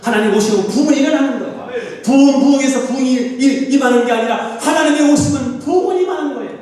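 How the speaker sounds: high, shouted pulpit declaration; preaching at full force. Real, not acted. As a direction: proclaim revival with urgency. Emphatic revival preaching.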